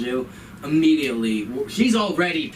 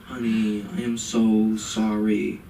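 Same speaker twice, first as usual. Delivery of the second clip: sudden sympathy; slower than usual. Retold or imitated. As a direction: switch to syrupy sympathy. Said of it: "an immediate tone switch".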